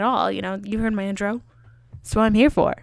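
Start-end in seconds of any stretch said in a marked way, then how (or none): none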